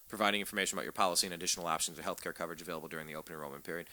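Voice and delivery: fast flat reading of a notice; speed-read legalese; rushed reading. Shown as a demonstration. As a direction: rattle the fine print off fast. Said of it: "I'm reading here".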